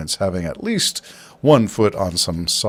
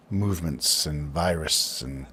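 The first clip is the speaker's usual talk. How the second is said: usual speaking voice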